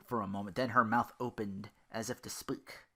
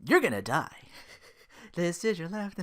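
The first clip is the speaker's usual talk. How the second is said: mock menace; 6 semitones higher, 5 dB louder than usual. Villain gloat ending poem.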